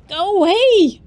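loud panicked yell; shouting. Real, not acted. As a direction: yell it at the chaser. Chased by creature, shouting.

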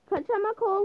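normal talking voice

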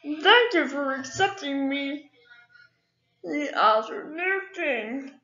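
high, slow, pompous royal voice; smug new king. Acted. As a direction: play a vain king greeting subjects. New king greets his subjects.

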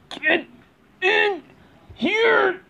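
high forceful shout. Pretend. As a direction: yell it as you shove. Shoving trash in.

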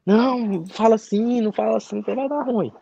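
mother scolding her son not to jinx it, imitated; imitating his mother. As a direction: chide him like a worried mum. Mother voiced scolding him.

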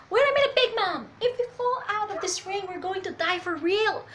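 high-pitched alarmed shouting; urgent high protest in character; acted panicked plea. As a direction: cry out a warning, starting loud and high. Voices panicked plea to Big Mom.

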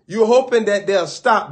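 ordinary talk

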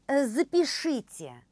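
normal talking voice

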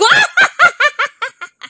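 high, loud witch cackle; diabolical laugh. Pretend. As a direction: shriek out an evil, staccato cackle. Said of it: "je le fais bien". (rire diabolique de sorcière)

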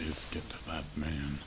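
breathy conspiratorial whisper. Acted. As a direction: whisper the plan so nobody hears. Plotting in secret.

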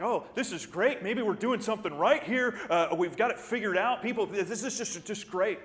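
loud, high gushing excitement with a laugh; excited church folk. Retold or imitated. Preacher voicing excited church members, loud gushing. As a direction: gush like you've figured it all out.

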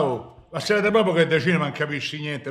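usual speaking voice

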